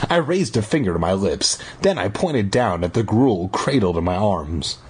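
usual speaking voice

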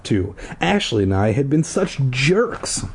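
usual speaking voice

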